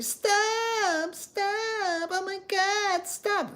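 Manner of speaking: high sing-song falsetto pleading; mock shrieking woman. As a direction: mimic a woman whining at her man's fight. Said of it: "Shouting at the other guy is like".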